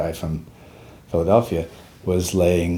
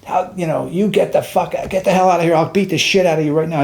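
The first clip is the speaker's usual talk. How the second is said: mad sergeant; 10 semitones higher than usual. Retold or imitated. Sergeant ordering a staring soldier away, retold anger. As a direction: chew him out in a raised, strained voice. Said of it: "he got mad at me".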